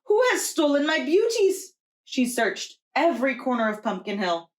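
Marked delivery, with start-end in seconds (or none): 0.1-1.7 put-on high, loud, heartbroken old woman; distressed cry. Old Juana wailing over her stolen pumpkins, high and loud. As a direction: cry it out to the empty hill. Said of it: "she cried".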